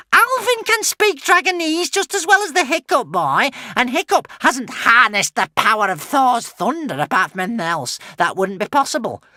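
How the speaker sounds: very high, piercing witch voice; scornful witch. Acted. Witch defends Alvin to the crowd, high and scornful. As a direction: dismiss the rival in a very high voice.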